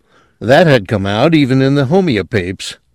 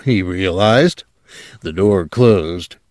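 ordinary delivery, nothing marked

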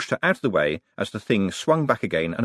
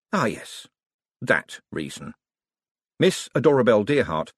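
usual speaking voice

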